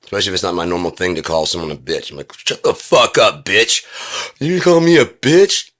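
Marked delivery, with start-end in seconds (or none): 2.3-3.8 loud, slow, raised-pitch snap; staged aggressive put-down; acted angry insult. Acted insult in imagined quarrel. 4.4-5.7 very high, loud, drawn-out disbelief; hammed-up shocked question; mock outrage. Acted shocked reply to insult.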